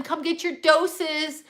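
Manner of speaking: mocking the store as a carnival huckster; mock carnival barker. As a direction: pitch it like a circus barker. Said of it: "We're in the circus".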